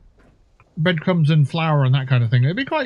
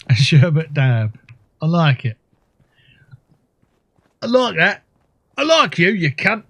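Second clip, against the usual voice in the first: mock Cockney voice; 3 semitones higher than usual. Player translating sherbet dab as cab, mock Cockney accent. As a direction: play a cheeky cartoon Cockney. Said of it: "Cockneys are weird".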